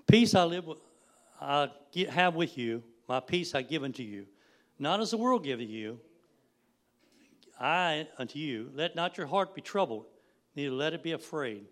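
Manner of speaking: loud, projected Bible proclamation; scripture reading. Real, not acted. Preacher reading John to the congregation, loud proclamation. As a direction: declare the verse out to the room.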